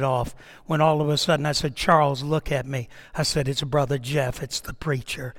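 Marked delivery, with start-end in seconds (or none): 1.8-5.3 low, hushed, soothing plea, much softer; calm and quiet. Retold or imitated. Preacher calming an armed man, quiet and low. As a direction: talk him down gently, barely above a murmur.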